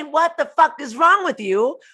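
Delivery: loud, high exasperated shout; mock furious outburst; angry yell. Shown as a demonstration. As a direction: snap at them in anger. Repeated yell example.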